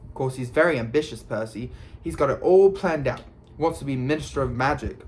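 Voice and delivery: plain reading voice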